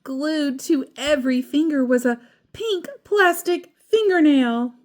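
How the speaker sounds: ordinary delivery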